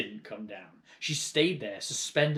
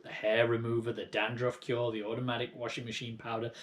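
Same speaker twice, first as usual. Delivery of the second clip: ordinary delivery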